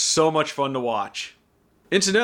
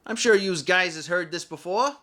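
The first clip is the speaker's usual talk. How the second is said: put-on fast-talking old-timey wise guy, slowed down and pitched up; 6 semitones higher than usual. Shown as a demonstration. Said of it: "so you get a lot of wise guy voices".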